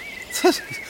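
a short airy nervous laugh; nervous breathy laugh. Acted. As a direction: laugh it off in disbelief.

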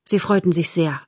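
plain reading voice

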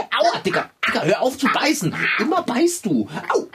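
ouch, stop biting me; pained complaint. Acted. Owner scolding the biting cat, pained high yelp. Cat bites her arm.

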